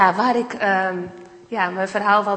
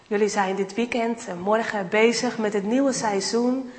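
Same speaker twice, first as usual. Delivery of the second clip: ordinary talk, nothing marked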